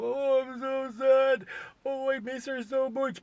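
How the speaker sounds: high, drawn-out mock wail; fake grief. Husband putting on grief for wife's mother, fake-sad. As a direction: lay on the phony sorrow, high and slow. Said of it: "I'd say to her mom".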